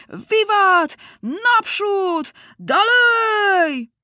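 high, drawn-out cheering yells; charging battle cries. A narrator's reading. Soldiers running from the trenches, exultant high shouts. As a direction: shout them high, stretching the last word. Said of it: "krzyczeć".

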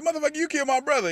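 high, fast mock outrage. Retelling the shooting scene to friends, fast squeaky mock outrage. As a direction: rattle it off squeaky and quick. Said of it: "he said".

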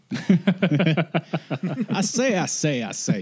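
mock Southern warm-up phrase; Southern accent. Shown as a demonstration. Showing how to get into character, Southern accent. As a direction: repeat it to slip into a Southern accent. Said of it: "say that to get into the accent".

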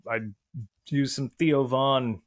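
normal talking voice

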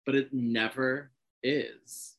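slow, drawn-out point; slow it right down; slow emphatic. Real, not acted.

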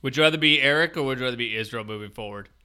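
ordinary talk

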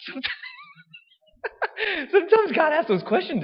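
high, breaking into laughter; giggling mid-sentence; laughing. Real, not acted. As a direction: laugh through the words. Laughter heard on lines.

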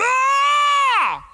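loud, high-pitched open scream; frustrated yell. Acted. Worn-out parent home to chaos, yelling at the family. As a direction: let out one long, high yell. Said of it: "what happens is you yell".

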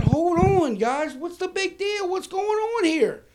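high, drawn-out fake surprise; mock innocent protest. Arrested man playing innocent to three cops, high mock surprise. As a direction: play dumb to the cops, sing-song and high.

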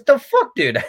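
very high, loud exasperated outburst; mock outraged disbelief. Brother learns it's off to the airport, mock outrage. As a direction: burst out in appalled disbelief. Brother appalled his fix failed.

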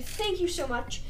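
ordinary talk

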